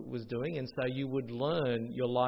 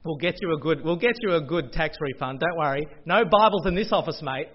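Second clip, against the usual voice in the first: mock dodgy accountant; 3 semitones higher, 8 dB louder than usual. Accountant telling the minister no Bibles here, brash mock reassurance. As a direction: ham up the knowing wink. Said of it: "where he said".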